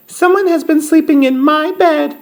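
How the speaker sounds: very high tiny cub voice; crying-out Baby Bear. A narrator's reading. Baby Bear finds his bed slept in, high cry. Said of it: "cried Baby Bear as loudly as he could".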